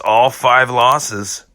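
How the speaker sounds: ordinary talk, nothing marked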